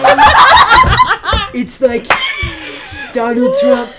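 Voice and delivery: laughing. Real, not acted. Cracking up over Trump joke.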